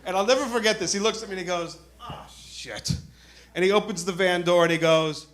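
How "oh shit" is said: whispered, under-the-breath swear; hushed realisation. Retold or imitated. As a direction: let it out in a stunned whisper. Cop's realisation in retold story.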